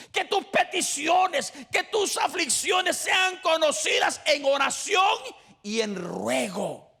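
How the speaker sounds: loud, rising, fervent preacher's cry; slow, loud, shouted sermon with drawn-out Orad; preaching at full force. Acted. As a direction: preach it out to the back row.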